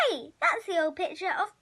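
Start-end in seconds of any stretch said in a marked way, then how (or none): none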